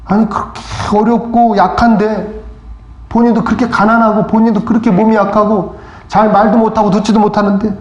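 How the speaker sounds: ordinary talk, nothing marked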